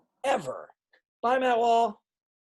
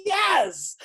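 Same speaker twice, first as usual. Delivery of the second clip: excited; 6 semitones higher, much slower than usual. Retold or imitated. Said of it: "I was like".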